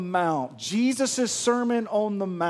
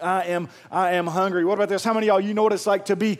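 usual speaking voice

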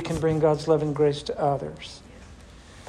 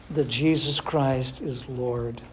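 ordinary talk

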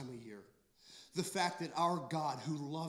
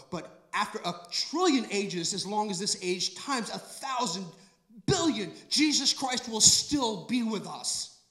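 rising preaching; 4 semitones higher, 5 dB louder than usual. Acted. Building preaching crescendo.

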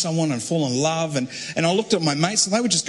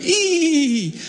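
put-on girly squeal; 10 semitones higher than usual. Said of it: "wandering off going".

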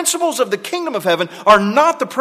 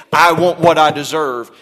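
louder, insistent claim; loud demand; 3 semitones lower, 9 dB louder than usual. Retold or imitated. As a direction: demand your due out loud. Preacher voices an entitled demand.

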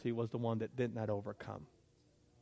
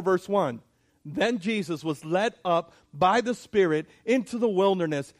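rising preaching; 5 semitones higher, 6 dB louder than usual. Acted. Preacher building up to scripture.

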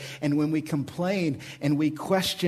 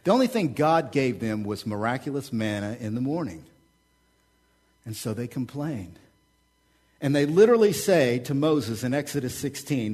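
normal talking voice

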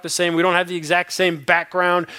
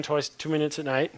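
usual speaking voice

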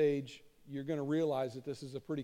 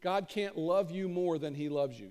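ordinary delivery

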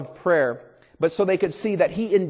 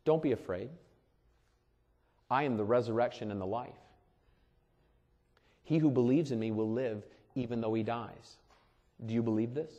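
softly spoken; 3 semitones lower, 6 dB quieter than usual. Retold or imitated. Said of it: "Imagine I said".

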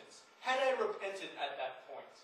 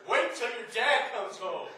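louder, slow, ominous warning; dreaded mom threat; 7 dB louder, slower than usual. Retold or imitated. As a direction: drag out the threat word by word. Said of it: "the dreaded words".

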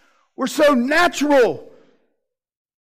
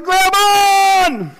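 high, loud call to grab on; urgent shout; 7 semitones higher, 5 dB louder than usual. Acted. Rescuer calling to a drowning man, loud urgent shout. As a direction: yell it like a lifeguard throwing a rope. Throwing the lifesaver.

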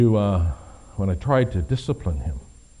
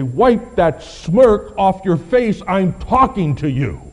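louder, higher, slow stern father's scold; 9 semitones higher, 8 dB louder, slower than usual. Retold or imitated. Father scolding a smirking son, stern and slow. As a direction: bark it like a dad at a smirking son. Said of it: "as my father would say".

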